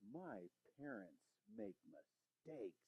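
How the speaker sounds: slow, soft hushed confession; hushed secret. Retold or imitated. The kids admitting a secret about their parents, slow and hushed. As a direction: let it out like a secret. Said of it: "this secret".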